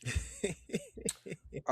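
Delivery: high, quick run of chuckles; chuckling, high and helpless; amused laugh. Real, not acted. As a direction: laugh at the teasing question. Tough-game question lands as joke.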